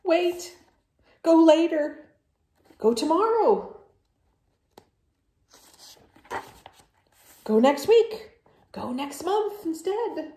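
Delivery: high, loud bargaining; desperate pleading. Acted. Gerald begs Piggy to wait, high and loud.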